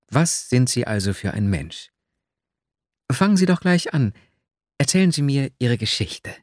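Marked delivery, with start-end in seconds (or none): none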